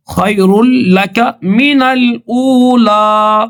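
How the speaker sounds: melodic measured tilawat; Quran recitation. Acted. Teacher recites the surah verses for students to follow. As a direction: chant each verse, stretching the long vowels.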